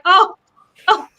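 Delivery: high, loud delighted oh; excited recognition. Retold or imitated. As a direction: gasp it out as if spotting an old friend. Mimicking excited recognition of Chaucer.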